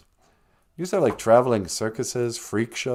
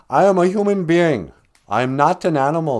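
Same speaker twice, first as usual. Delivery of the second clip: loud, higher hammed-up plea; mock dramatic shout; 6 semitones higher, 8 dB louder than usual. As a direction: ham up the Elephant Man's famous cry. Quoting Elephant Man line, big and loud.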